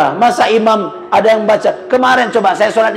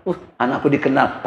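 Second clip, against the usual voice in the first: proud father; 5 semitones lower, 5 dB quieter than usual. Retold or imitated. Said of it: "Bapaknya Anton malah bangga".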